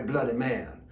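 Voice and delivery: plain reading voice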